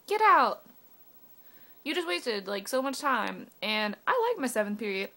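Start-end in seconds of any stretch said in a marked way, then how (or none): 0.0-0.6 high, loud mimicking of an organizer; mock announcement. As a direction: imitate them calling out to the gym. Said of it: "listening to you be like".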